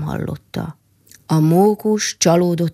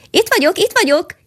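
much higher, louder, ringing squirrel call; tinkling call; 12 semitones higher, 4 dB louder than usual. Acted. Squirrel answers the lost mole with a much higher, louder tinkling call. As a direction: ring it out like a little bell. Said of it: "csilingelt a mókus".

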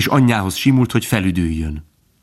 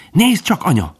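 high, excited call from a little fawn; izgatott kiáltás; 10 semitones higher than usual. A narrator's reading. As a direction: call out to mother, pitch leaping then falling. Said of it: "kiáltott Bambi". (izgatott, magas kiáltás)

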